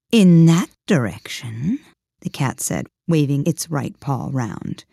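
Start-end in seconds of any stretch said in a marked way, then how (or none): 0.1-1.8 slow, louder cat pointing the way; lightly voiced cat. The Cheshire Cat pointing Alice one way, slow and louder. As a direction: draw it out like a lazy cat.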